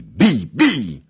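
loud battle cry; shouts. A narrator's reading. Brawling soldiers shout to strike, loud. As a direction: yell like a brawling crowd. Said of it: "Rozległy się krzyki".

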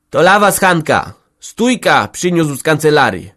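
loud, high announcing voice; excited village woman. Acted. Village head's wife announcing an official paper, loud. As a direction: hand over the news eagerly.

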